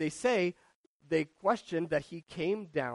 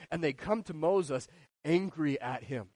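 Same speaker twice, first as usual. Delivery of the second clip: ordinary talk, nothing marked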